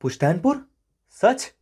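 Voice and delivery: astonished "can it be true?"; delighted surprise. Acted. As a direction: ask it in happy disbelief, voice leaping up. Hero reacts to surprise news.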